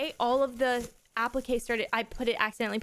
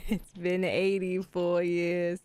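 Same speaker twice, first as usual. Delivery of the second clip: put-on low, slow creaky elderly voice; old lady quote; 5 semitones lower, much slower than usual. Quoting the Titanic line about a gift, slow and low. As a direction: drag it out like an old woman.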